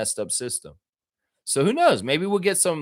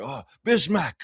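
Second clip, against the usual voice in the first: Godfather voice; 4 semitones higher than usual. Pretend. The Don calling Bismack into his office, mild Godfather voice. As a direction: call him in like the Godfather, lightly. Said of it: "A little Godfather right there".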